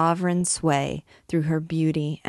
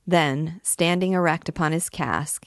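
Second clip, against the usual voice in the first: ordinary delivery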